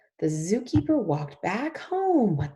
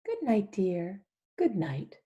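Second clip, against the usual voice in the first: normal talking voice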